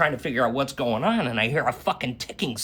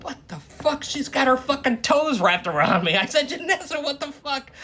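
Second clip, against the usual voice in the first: startled outburst; 10 semitones higher than usual. Retold or imitated. Reacting to toes.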